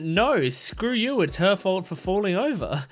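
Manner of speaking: put-on bratty brush-off, stretched out; snotty dismissive voice. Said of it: "And she went".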